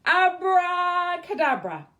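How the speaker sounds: witch's shout. Acted. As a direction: cast the spell with a big call.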